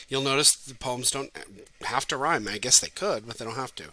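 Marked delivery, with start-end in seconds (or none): none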